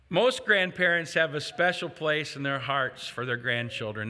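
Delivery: normal talking voice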